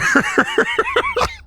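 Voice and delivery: high wheezing cackle; helpless laughter. Real, not acted. Player who loves the randomizer, helpless laughter. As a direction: crack up completely.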